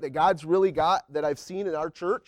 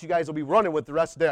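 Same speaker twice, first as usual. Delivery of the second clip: ordinary talk, nothing marked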